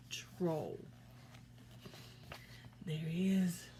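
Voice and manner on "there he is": put-on hushed whisper to the kids. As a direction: breathe it like a secret. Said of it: "whispering to children".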